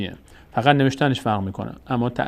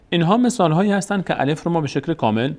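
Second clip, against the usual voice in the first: normal talking voice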